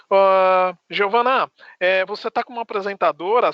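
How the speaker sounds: ordinary talk, nothing marked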